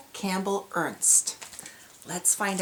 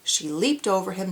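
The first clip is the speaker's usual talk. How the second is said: ordinary delivery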